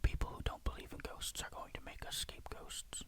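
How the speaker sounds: ordinary delivery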